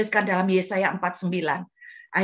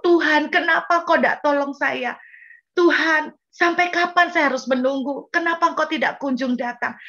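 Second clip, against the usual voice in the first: loud, high pleading to God; retold desperate prayer; 6 semitones higher, 3 dB louder than usual. Mother recalling her old prayers to God, pleading. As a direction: cry out to God in complaint. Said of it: "Cara saya berdoa nggak seperti dulu".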